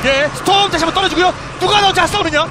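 loud, high-energy esports call; live play-by-play. Real, not acted. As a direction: shout the fight as it happens.

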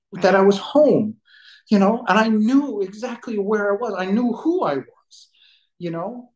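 choked up, pitched high and unsteady in level; emotional. Real, not acted. Man recalling the moment he felt he was home, emotional. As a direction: fight back real tears while telling it. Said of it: "I'm sorry, I'm emotional".

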